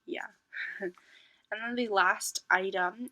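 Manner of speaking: ordinary talk, nothing marked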